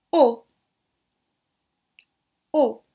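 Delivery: "O" as a short, closed, rounded oh; closed O sound. Shown as a demonstration. Teacher showing the sound of A plus U, closed O. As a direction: punch out a closed French "O", loud. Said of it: "Aí o meu som fechadinho".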